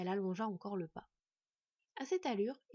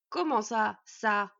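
very loud, indignant dwarf; choked outrage; 10 dB louder, much slower than usual. A narrator's reading. Dwarf to the boy who slighted him, loud outrage. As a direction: splutter at the insult. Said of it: "s'étrangla un Nuri outré".

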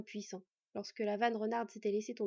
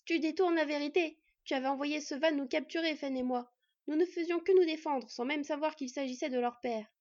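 loud, raised accusation in bursts; shouting; 5 semitones higher, 6 dB louder than usual. A narrator's reading. Borde shouting at Hull to defend himself, shouting. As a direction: cry out in indignant self-defence. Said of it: "Il cria à Hull".

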